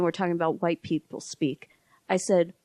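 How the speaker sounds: ordinary delivery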